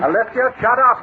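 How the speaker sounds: sharp, high, loud order to shut up; angry command. Acted. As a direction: bark it at his sister, losing patience. Brother silencing sister in a quarrel.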